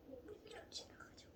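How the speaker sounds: whispered, unvoiced aside; whispering. Real, not acted. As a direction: breathe it as a whisper. Repeats line in whisper.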